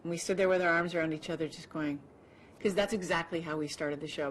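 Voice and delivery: ordinary talk, nothing marked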